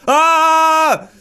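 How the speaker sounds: long, loud high-pitched "aah"; startled scream. Real, not acted. Player attacked by a game spider, alarmed scream.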